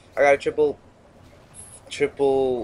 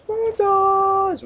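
sing-song; 4 dB louder than usual. Pretend. Announcing montage, drawn out.

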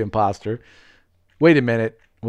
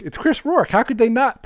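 excited name call; 10 semitones higher than usual. Real, not acted. Saying viewer's name correctly.

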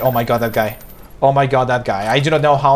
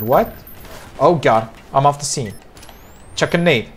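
usual speaking voice

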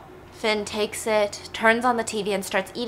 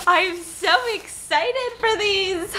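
gasp, then high, drawn-out squeal; high and slow, savouring the anticipation; giddy excitement; 10 semitones higher, slower than usual. Real, not acted. Baker eager for warm cookies, real giddy excitement.

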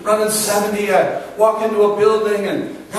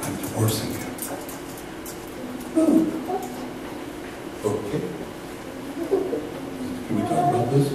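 hushed re-enactment of bad news; subdued phone exchange; 9 dB quieter than usual. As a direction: say it quietly, with long stunned pauses. Re-enacts hushed divorce phone call.

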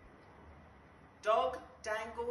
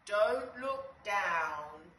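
slow warning; 5 dB louder, much slower than usual. Acted. Bug warning Dave.

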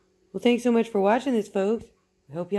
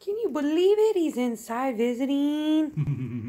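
high, slowing sing-song coo; gushing pet talk; 8 semitones higher than usual. Real, not acted. Owner gushing that the pet is inside visiting, high cooing. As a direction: start very high and draw it out adoringly.

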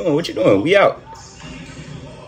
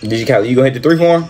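quick repeat; faster than usual. Real, not acted. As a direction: rattle it off fast. Same call repeated quickly.

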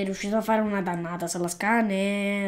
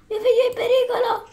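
much higher, fast falsetto wail with a laugh breaking through; hammed-up frantic mother; 12 semitones higher, 4 dB louder, much faster than usual. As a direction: overplay the mother's panic until you crack up. Same cry, exaggerated and laughing.

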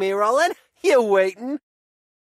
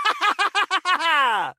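triumphant laugh. Acted. Warrior celebrating a total party kill with a big laugh. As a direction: cackle in victory after a total wipe.

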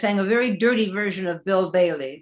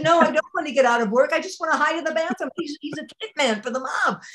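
panicky; 10 semitones higher, faster than usual. Retold or imitated. Panicked plea to fellow bunny.

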